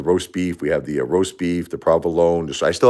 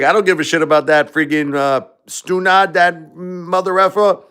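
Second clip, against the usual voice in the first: Brooklyn music teacher; 13 semitones higher, 5 dB louder than usual. Retold or imitated. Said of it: "She's like".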